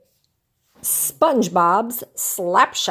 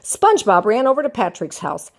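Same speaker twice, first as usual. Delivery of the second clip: plain reading voice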